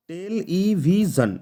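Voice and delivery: টেল ই ভিশন slowly, in separate beats; syllable by syllable. Shown as a demonstration. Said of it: "চারটা syllable দিয়ে গঠিত হয়েছে".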